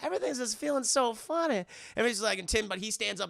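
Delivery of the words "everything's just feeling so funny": high, odd put-on voice; Mister Brown. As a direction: imitate a man gone giddily weird. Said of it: "starts acting weird too".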